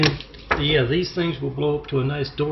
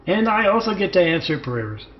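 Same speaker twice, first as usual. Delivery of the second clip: usual speaking voice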